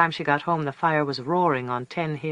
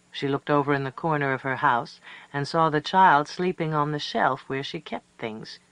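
ordinary delivery

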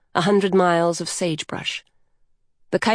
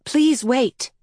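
higher-pitched, voiced child's plea; spoken plea; 7 semitones higher than usual. A narrator's reading. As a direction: plead aloud in a higher voice. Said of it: "This time she spoke".

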